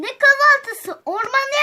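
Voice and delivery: indignant shout. Acted. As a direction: shout it in disbelief. Mother objects to breakfast.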